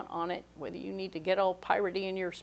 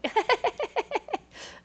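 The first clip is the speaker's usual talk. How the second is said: high real laugh; 5 dB louder than usual. Real, not acted. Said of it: "I'm laughing because".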